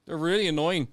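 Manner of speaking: usual speaking voice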